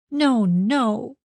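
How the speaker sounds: high, pleading protest; crying out. A narrator's reading. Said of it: "she cried".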